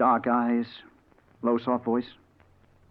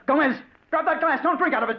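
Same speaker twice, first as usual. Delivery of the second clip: urgent high yell, louder; 3 dB louder than usual. Acted. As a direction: shout a warning across the ward. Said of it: "and I yelled".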